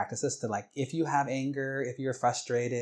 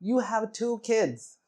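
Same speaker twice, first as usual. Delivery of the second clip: high-pitched exasperated scolding; yelled; 6 semitones higher, 3 dB louder than usual. Retold or imitated. Wife scolding her friend over Big Macs, yelling. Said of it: "she yelled at my mom".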